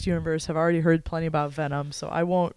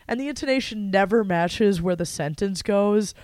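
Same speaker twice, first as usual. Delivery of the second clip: ordinary talk, nothing marked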